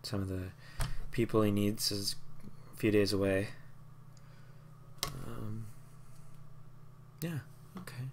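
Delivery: soft, subdued reply; grieving woman. Acted. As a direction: speak gently, weighed down by loss.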